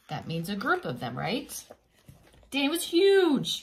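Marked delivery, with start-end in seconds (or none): 2.5-3.6 huge stretched out high and loud; big emphasis on huge. A narrator's reading. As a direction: make "huge" sound enormous. Emphasising size for child.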